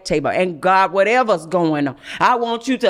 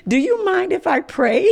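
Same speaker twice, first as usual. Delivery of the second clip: higher, through a laugh; 9 semitones higher than usual. Retold or imitated. Friend asking to pray over lunch, laughing. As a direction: ask it while giggling.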